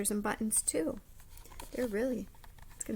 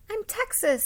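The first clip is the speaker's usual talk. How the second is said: put-on eager kid; 11 semitones higher, 5 dB louder than usual. Children announcing their states.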